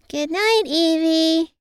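put-on high sing-song child goodnight; little girl. A narrator's reading. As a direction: call it out like a sleepy kid. Child says good night.

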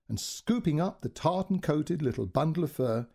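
plain reading voice

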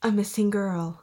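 a light take on a movie trailer announcer; ominous trailer narration, lightly done. Pretend. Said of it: "like, movie trailer voice".

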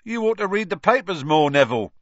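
high, loud cockney lad, mocking; Stan's voice. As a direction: tease him like a cheeky bus conductor. Stan lecturing Harry.

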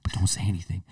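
hushed plea for secrecy; whispering. Retold or imitated. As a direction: say it softly, confidentially. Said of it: "I'm whispering like this".

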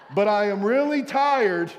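loud, high comic complaint; mock exasperated. Dad fed up with charger-taking kids, mock exasperated. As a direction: protest loudly, playing it for laughs. Continues comic rant about children.

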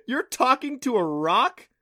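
high, loud, drawn-out mocking question; amused incredulity. Adam mocking Clancy for talking to stone, loud disbelief. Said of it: "Adam burst out laughing".

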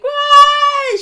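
loud, high-pitched fake bawling; hammed-up crying wail, held long; mock wail. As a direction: burst into an exaggerated attention-seeking wail. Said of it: "She starts to cry".

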